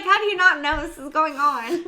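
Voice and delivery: loud incredulous question; exasperated. Retold or imitated. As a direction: ask it in disbelief.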